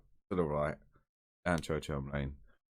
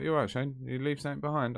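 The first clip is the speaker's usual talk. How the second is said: boss on the phone, much higher; 10 semitones higher than usual. Retold or imitated. Boss answers phone surprised.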